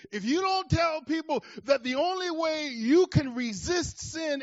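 loud, high, urgent preaching; fervent pulpit cry; impassioned preaching. Acted. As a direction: drive the point home to the congregation. Preaching at full force.

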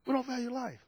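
hushed sorrowful echo of "We don't value life"; quiet repeat. Real, not acted. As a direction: repeat the line quietly to let it land. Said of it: "repeated quietly".

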